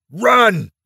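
sudden high, loud cry to flee; urgent cry. A narrator's reading. Eric crying out to his companions, loud urgent cry. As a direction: yell at them to run. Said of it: "Eric cried".

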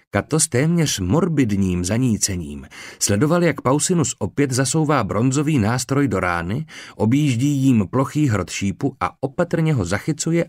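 usual speaking voice

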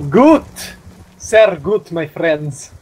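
mock German accent, cheering; hammed-up German "Sehr gut"; playful fake German accent. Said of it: "I did that German and Russian accent there".

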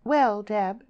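high, loud, haughty address; superior, cool opening; icy superiority. A narrator's reading. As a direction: talk down to a nosy friend. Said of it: "Lily replied with icy superiority".

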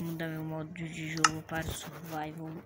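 hushed dramatic whisper. Pretend. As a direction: whisper it like a doomed hero. Whispered before survival fight. (sussurro dramático)

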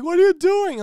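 high, loud mock dad yell; hammed-up shocked dad; dad shouting. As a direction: yell at the son mid-robbery. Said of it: "He's like".

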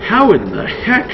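high, slow baffled cry; shocked disbelief. Acted. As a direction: gasp it out in disbelief. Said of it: "That is what I would say".

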